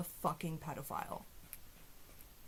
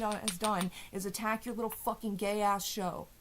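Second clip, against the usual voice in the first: ordinary delivery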